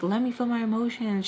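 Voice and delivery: ordinary talk, nothing marked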